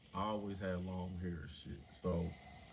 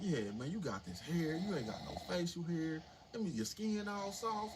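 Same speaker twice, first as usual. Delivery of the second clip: rising higher, mock warning; retold guard warning; 9 semitones higher than usual. Guard warning new inmate, mock rising tone. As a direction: pile the warning on.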